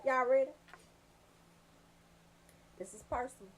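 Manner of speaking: ordinary talk, nothing marked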